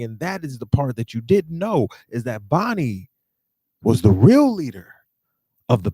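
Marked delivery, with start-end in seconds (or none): none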